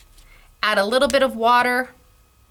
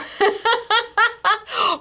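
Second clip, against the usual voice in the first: a quick high burst of laughter; bright laugh; 12 semitones higher than usual. Real, not acted. Laughing at the coffee stamp, high bright laugh.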